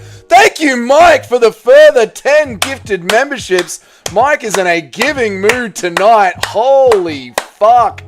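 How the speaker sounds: loud, high, drawn-out thanks; excited shout. Real, not acted. Streamer thanking Mike for gifted memberships, excited shout. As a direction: bellow it in amazed gratitude.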